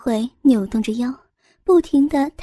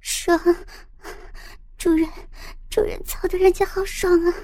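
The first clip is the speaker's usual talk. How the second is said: higher, yielding female voice; higher woman voice; 5 semitones higher, 4 dB quieter than usual. Acted. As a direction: give in breathlessly as the woman. Woman answers in pleasure.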